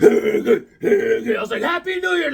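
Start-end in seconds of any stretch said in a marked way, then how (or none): 1.6-2.3 much higher, excited shout; shouted greeting. Retold or imitated. Vlogger greets the comic shop crew on New Year, shouted high. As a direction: call it out across the shop. Retold shout entering store.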